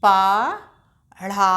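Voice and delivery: पढ़ा stretched very slowly; drawn-out syllable. Shown as a demonstration. Teacher sounds out पढ़ा slowly for children. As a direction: hold पढ़ा long for the class. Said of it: "तो इसको कैसे पढ़ेंगे?".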